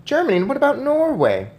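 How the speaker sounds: put-on a boy who can't hold back his questions; excited pleading; eager boy. A narrator's reading. Boy pressing grandmother, rising.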